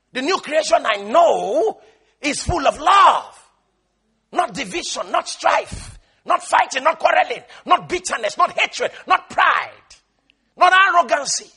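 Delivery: loud, high, emphatic sermon delivery; forceful preacher's litany; full-force preaching. Acted. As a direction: hammer each item at the congregation.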